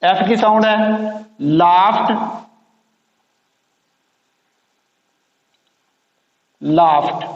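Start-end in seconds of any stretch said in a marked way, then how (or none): 1.4-7.2 लाफ्ड ending in t, laft. Shown as a demonstration. Teacher showing the t ending of लाफ्ड to the class. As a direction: end लाफ्ड on a crisp t. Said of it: "ईडी की आवाज़ ... टी की आवाज़ बनी है".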